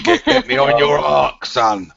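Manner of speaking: lightly voiced gruff Cockney geezer, slow; a hint of a tough London hardman; Ray Winston voice. Mates egg him on, mock Ray Winston drawl. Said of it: "Your Ray Winston voice".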